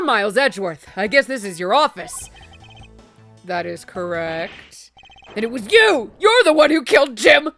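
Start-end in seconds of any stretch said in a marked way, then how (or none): 3.4-4.9 cool prosecutor voice. Acted. Voicing Edgeworth. 5.4-7.5 loud, indignant accusation; accusing shout. Acted. Accusing as the jock.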